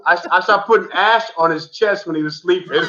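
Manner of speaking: laughing through the words; giggling while telling it. Real, not acted. Laughing while confessing prank.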